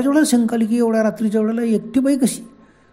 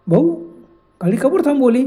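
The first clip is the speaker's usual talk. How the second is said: higher, louder sweet-sounding call; ghost woman's voice; 6 semitones higher, 6 dB louder than usual. A narrator's reading. Ghost woman asks the driver why he stopped, raised sweet call. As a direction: lure them in with a sweet raised voice. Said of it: "ती ताई म्हणाली".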